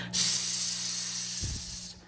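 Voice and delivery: soft, sustained sizzling hiss; long hissing S. Shown as a demonstration. Storyteller showing gators sizzling in the swamp, soft long hiss. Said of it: "It sounded like the S sound".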